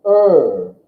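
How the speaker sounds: "er" as in kernel; vowel demo. Shown as a demonstration. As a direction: round "er" vowel. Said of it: "O becomes".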